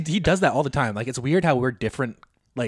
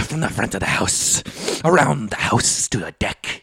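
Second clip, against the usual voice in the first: wizard; 2 semitones lower than usual. Retold or imitated. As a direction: proclaim it slowly like a mythical prophecy. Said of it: "wizard from a mythical land".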